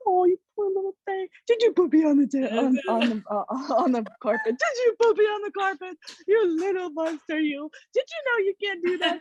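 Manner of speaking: put-on high, fast baby talk to a pet; doting wife. Comedian's wife fussing over the dog, high baby talk. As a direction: coo at the naughty dog like a baby. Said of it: "and my wife is like".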